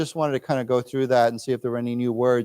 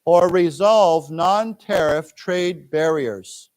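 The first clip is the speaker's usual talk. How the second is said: very slow model; 3 dB louder, much slower than usual. Shown as a demonstration. Teacher reads line for students to echo, very slow. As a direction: spell it out slowly for learners. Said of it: "let's read this".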